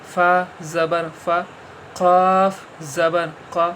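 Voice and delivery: slow, syllable by syllable letter drill; slow spelling drill. Shown as a demonstration. Teacher drilling zabar letters for pupils, slow and deliberate. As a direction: spell each letter out slowly for children. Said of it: "ہجے کر دیجئے".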